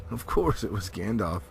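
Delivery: plain reading voice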